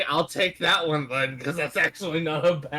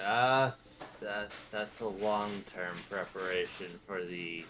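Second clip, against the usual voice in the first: low, very soft, halting; quiet musing; 7 semitones lower, 11 dB quieter than usual. Acted. Dragos weighing long-term preparation, low halting musing. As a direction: muse it quietly to yourself. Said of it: "He's like".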